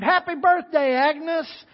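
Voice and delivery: loud, high crowd cheer; shouted greeting. Acted. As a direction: yell it out as the door swings open. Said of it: "they all shouted and screamed".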